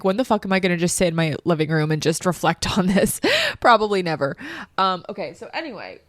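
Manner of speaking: normal talking voice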